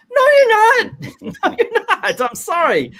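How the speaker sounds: very high, loud mocking squeal of disbelief. Scoffing at a wrestler's broken ribs, in a shrill mock squeal. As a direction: squeak it out like a scandalised nanny.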